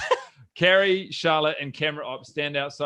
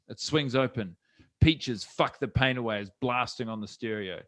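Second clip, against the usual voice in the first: normal talking voice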